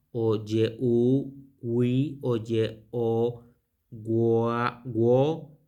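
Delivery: "ye, u, gui, ye, o, gua, guo" syllable by syllable; pronunciation demo. Shown as a demonstration. Teacher listing possible vowels of the name, sounded out separately. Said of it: "y la pronunciación gua".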